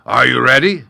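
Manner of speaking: loud, lordly lion agreeing; mocking lion. A narrator's reading. Lion accepting the gnat's fight, loud and lordly. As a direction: indulge the little fool.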